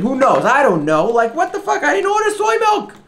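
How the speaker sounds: shrill, loud, pitch climbing to a yell; outraged rant. Real, not acted. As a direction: let the outrage explode upward. Ranting at absurd delivery.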